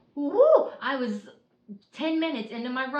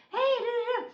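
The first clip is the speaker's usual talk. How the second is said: husband's singsong greeting. Retold or imitated. Husband greets her arriving home.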